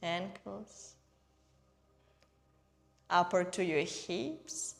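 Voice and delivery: unhurried meditation guide, evenly paced; guided meditation. Real, not acted. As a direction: guide them gently through each breath, leaving space. Leads breathing and guided meditation.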